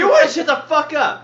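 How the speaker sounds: high-pitched exasperated snap; fed-up retort at the table's heckling; angry outburst. Real, not acted. Player telling off laughing friends, angry. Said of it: "So much anger this time".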